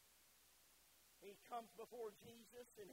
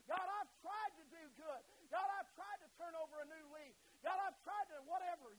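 crying out to God; 6 semitones higher, 10 dB louder than usual. Acted. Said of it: "I cry out to Him".

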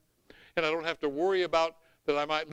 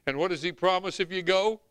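ordinary talk

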